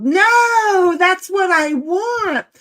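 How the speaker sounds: sudden shout of no, then slow and insistent; loud no, then a slow insistent demand; loud protest. Real, not acted. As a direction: shout the no and drag out the rest. Protesting MJ's suggestion.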